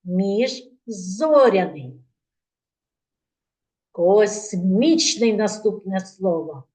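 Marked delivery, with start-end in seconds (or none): 0.0-2.0 Міжзоряний said slowly, each syllable clear; word spelt out clearly. Shown as a demonstration. Teacher to class repeating: Міжзоряний slow and clear. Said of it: "Повторюємо разом зі мною".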